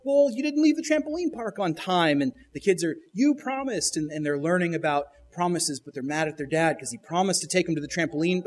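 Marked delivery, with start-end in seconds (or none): 0.0-2.2 higher mock dad. Dad answers the kids. 3.1-4.0 put-on higher childish accusation. Kids insist to dad.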